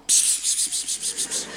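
soft voiceless "psps" to call a cat; repeated soft sibilant cat call; cat-calling hiss. Shown as a demonstration. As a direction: coax a cat with quick whispered pss sounds. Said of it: "En wel deze klank".

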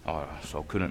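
low, soft, feigned modesty; quiet false modesty; mock modest mumble. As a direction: shrug it off, too humble.

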